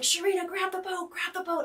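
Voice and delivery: high, loud crew calling out; imitated urgent yelling. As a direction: yell an order across the boats, imitating them. Imitates everyone yelling during the crash.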